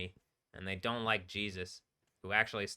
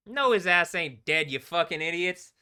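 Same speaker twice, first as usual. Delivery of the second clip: higher, louder jeering retort; louder mocking retort; 8 semitones higher, 10 dB louder than usual. Real, not acted. Streamer repeating the taunt at the players, louder. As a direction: throw it back at them louder.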